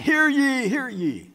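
town-crier call, high then falling; high public summons, easing on the repeat; crying out. Shown as a demonstration. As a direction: proclaim it in an outdoor voice. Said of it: "That kind of a crying out".

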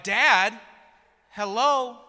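high, loud mock-kid protest; imitated toddler stating the obvious; exasperated child. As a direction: call dad out like a small child. Son answering exasperated dad.

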